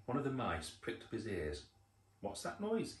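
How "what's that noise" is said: very high little mouse squeak; squeaky. A narrator's reading. Mouse hearing a strange noise, squeaking. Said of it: "he squeaked".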